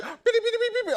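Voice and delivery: very high, loud beeping gibberish; squeaky mock cursing; imitated angry babble. Preacher mimicking the cussing scammer, high-pitched beeping.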